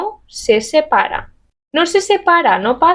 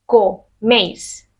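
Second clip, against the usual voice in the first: coméis dicho despacio por sílabas; split into syllables; 2 semitones lower, much slower than usual. Shown as a demonstration. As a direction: slowly break coméis into syllables. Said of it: "Si esto lo divido en sílabas". (coméis lento, separado en sílabas)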